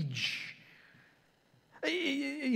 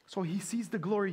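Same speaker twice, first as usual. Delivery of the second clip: ordinary delivery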